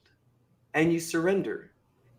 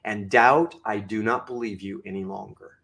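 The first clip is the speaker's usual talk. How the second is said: very low, measured dismissal of doubt; low, deliberate and resolute, softening after the address; firm self-talk; 10 semitones lower than usual. Shown as a demonstration. Speaker addressing his own doubt, firm self-talk. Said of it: "I talk like this all the time".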